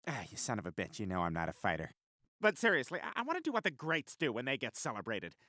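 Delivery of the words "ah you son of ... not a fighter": low, soft grumble; subdued. Acted. Man who is no fighter backs down, subdued. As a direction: drop low and quiet, backing down. Backing down, muttered aside.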